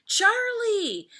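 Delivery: high, loud happy call of the name; excited cry. Acted. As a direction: cry out the cat's name in delighted recognition. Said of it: "Sarah and Elizabeth cried".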